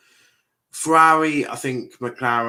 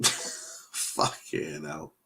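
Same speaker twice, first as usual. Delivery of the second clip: breathless laughing curse; 5 semitones lower, 5 dB quieter, much slower than usual. Real, not acted. Laughing at clip.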